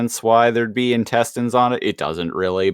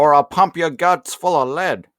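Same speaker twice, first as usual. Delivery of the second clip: tough-guy gangster threat, pitched up; gangster movie quote; 6 semitones higher than usual. Retold or imitated. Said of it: "that Home Alone quote".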